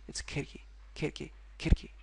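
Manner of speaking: khirki whispered with a flapped retroflex. Shown as a demonstration. Teacher whispers the right khirki. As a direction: khirki breathed softly, curled tongue. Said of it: "It's not khirki".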